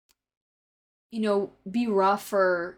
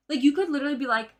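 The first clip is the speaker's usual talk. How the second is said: ordinary delivery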